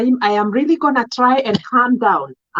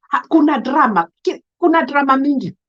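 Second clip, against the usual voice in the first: ordinary delivery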